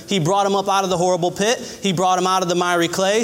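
ordinary talk, nothing marked

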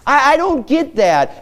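a lightly voiced, puzzled Peter, going high on the last line; confused Peter. Preacher voicing Peter questioning Jesus, lightly puzzled. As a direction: play Peter lost and asking Jesus to explain. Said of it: "Peter standing and say".

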